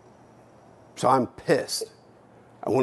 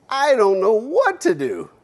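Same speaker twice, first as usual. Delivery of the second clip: put-on high, rising cry of a helpless child; reliving a child's overwhelmed protest; helpless distress; 8 semitones higher, 4 dB louder than usual. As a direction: plead it high-pitched, stretching the words.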